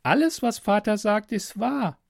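put-on very high girlish voice, insistent; captive girl, dogmatic. Girl repeats father's word to stranger, high and certain. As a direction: recite father's creed as a trusting girl, pitched high.

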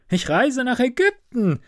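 high, lifted swallow's call. A narrator's reading. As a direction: announce it far up in pitch. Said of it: "rief die Schwalbe". (hoch und hell gerufen)

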